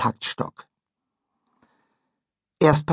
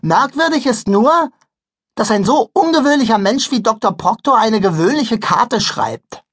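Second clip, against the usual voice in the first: put-on girl voice; 7 semitones higher, 4 dB louder than usual. Liese explains.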